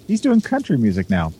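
normal talking voice

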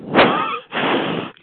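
sharp intake of breath; gasp. Real, not acted. Gasp before mocking the tall story.

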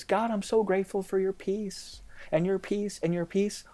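high, soft, sing-song fake gratitude; hollow pious prayer. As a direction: pray a sweet show of thanks you don't feel. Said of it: "what we've been saying in our prayer life is".

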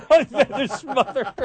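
cracking up, high-pitched; helplessly amused; laughing. Real, not acted. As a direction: repeat the punchline through laughter. Host cracks up repeating the punchline.

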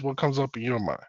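normal talking voice

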